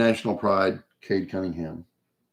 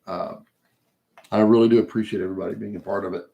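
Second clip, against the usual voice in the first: ordinary talk, nothing marked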